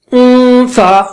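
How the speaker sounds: اون سا with the n held as a long nasal hum; nasal ghunna. Shown as a demonstration. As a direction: hum اون through the nose, then release into سا. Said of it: "ناک سے آواز نکالیے".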